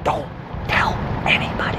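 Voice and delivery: hushed plea to keep quiet; whispering. Pretend. Begging to keep secret.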